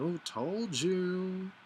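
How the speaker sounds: high, drawn-out, sing-song gloating; mocking told-you-so. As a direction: stretch it out like a smug friend, pitch climbing. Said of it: "Or if they would".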